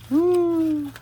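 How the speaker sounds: high, loud happy whoop; excited woo. Real, not acted. Excited cheer.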